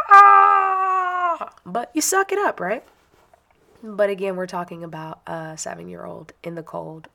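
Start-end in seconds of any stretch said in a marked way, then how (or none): 0.1-1.5 sudden high loud cry of pain; pained yelp. Retold or imitated. Imagining a cold foot stepped on, high pained yelp. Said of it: "it's like".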